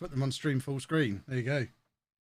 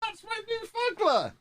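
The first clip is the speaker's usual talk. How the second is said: very high, squealed objection; alarmed protest; slower than usual. Real, not acted. Streamer protecting the new fuggler, high alarmed protest. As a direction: cry out in high-pitched protest.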